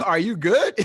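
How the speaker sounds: high, loud, laughing through it; laughing question. Retold or imitated. Diver laughing as he asks if he's good. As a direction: chuckle the question out.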